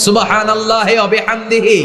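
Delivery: high-pitched call to repeat; ringing chant for the congregation to echo; leading recitation. Shown as a demonstration. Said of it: "পড়েন".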